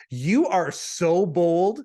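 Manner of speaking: slow, drawn-out mock scolding; teasing, as if naughty. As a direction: imitate her playfully scolding, stretching each word. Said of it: "could have subbed in the word naughty".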